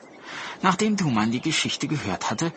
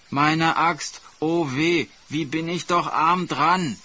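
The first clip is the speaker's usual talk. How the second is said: higher, hammed-up self-pity; mock lament, laid on thick; put-on wailing; 5 semitones higher than usual. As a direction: whine theatrically for an audience. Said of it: "gab sich mit seinem Gejammer alle Mühe".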